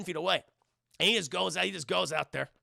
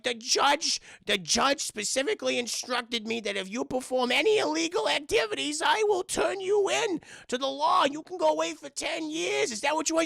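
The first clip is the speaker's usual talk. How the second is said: high, louder scolding cartoon old man; Whitey; 8 semitones higher, 4 dB louder than usual. Whitey warning Davey about the judge, high scolding voice. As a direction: climb higher as the stern warning builds. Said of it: "And he's like".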